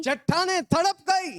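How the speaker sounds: high, forceful proclamation; emphatic preaching echo. Real, not acted. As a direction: cry the verse out to the congregation. Preacher echoes reader with force.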